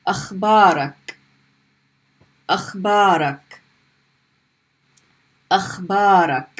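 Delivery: akhbarak with throaty kh, ending in a light k; model word akhbarak. Shown as a demonstration. Teacher models akhbarak for students to repeat. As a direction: say akhbarak slowly and clearly. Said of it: "Let's do".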